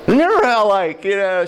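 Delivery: high-pitched teasing reminiscence; gleeful wife, imitated. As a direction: mimic a wife enjoying the ribbing. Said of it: "she was having a great time".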